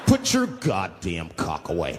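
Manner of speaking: very low, slow command; deep-voiced passenger. Newly brave passenger orders the man, deep and slow. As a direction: drop your voice and order him slowly.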